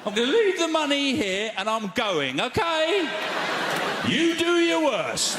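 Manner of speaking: high, slow, dramatic challenge; mock defiance. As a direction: dare the machine, drawn out and high. Defying the self-checkout machine.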